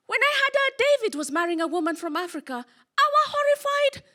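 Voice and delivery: imitating an English mother-in-law, leaping high on "horrified"; mock-horrified mother-in-law, pitch jumping at the end. Mother-in-law confiding to the bride, mock horror rising high. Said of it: "my very English mother-in-law".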